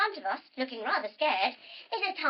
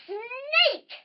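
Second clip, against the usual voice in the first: high, loud drawn-out pounce; spring the word on the children; mock-alarmed high cry; startling reveal; 11 semitones higher, 6 dB louder than usual. Reveals the snake.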